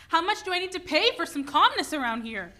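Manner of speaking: wife fed up with the noise; exasperated. Acted. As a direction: throw up your hands.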